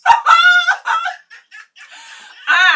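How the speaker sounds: a big burst of high laughter; loud laugh. Real, not acted. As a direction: burst out laughing at her line. Sister's joke, big laugh.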